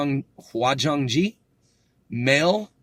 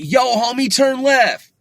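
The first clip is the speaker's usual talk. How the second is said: loud, much higher hammed-up homie shout; mock street-slang call; 11 semitones higher, 7 dB louder than usual. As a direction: yell it at your buddy like a cartoon homeboy. Acting out slangy directions to a buddy.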